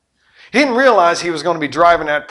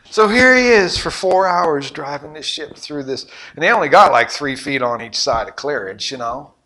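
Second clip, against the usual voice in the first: ordinary talk, nothing marked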